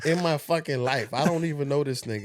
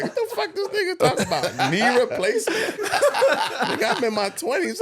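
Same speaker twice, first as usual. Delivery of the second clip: high and loud, laughing disbelief; you-serious comic shock; mock-offended amazement; incredulous; 13 semitones higher, 5 dB louder than usual. Retelling his baffled reaction.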